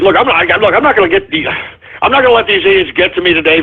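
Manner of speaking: ordinary delivery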